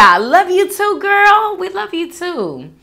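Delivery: high, loud love you back; gushing. Real, not acted. Answering a viewer's love, high and gushing. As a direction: gush it back sweetly and high.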